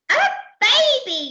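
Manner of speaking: high, loud falling exclamation; yelled. Acted. Percy announcing it to the crew, high yell. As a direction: shout it out at the top of the voice. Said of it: "yelled Percy".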